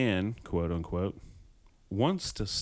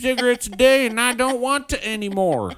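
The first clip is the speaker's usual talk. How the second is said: high, loud hammed-up old man voice; mock old man; 12 semitones higher, 7 dB louder than usual. Mocking the world's oldest smoker for her friend in a high, loud voice. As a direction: play up the old smoker's cheerful complaint.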